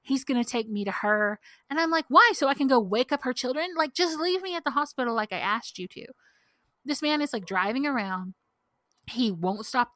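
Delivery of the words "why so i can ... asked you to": raised, louder indignant comeback; retold exasperated protest; exasperated retort. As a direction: argue back, demanding to be heard. Acted quote arguing with the driver.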